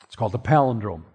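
normal talking voice

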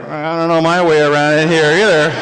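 low, deadpan character aside. Acted. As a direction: drop the voice for a sheepish admission.

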